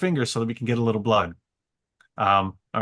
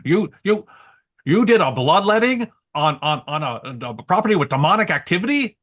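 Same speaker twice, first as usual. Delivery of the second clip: higher, louder mock scolding; imitating a yelling mentor; 6 semitones higher, 5 dB louder than usual. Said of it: "I'm getting yelled at out here".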